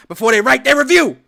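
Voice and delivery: building to a loud exasperated yell; rising rant; exasperated shout. Real, not acted.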